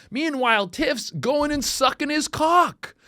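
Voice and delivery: high, loud disbelieving rant; incredulous shouting rant; rising outrage. Real, not acted. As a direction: build up the outrage, pitched high. Ranting in disbelief.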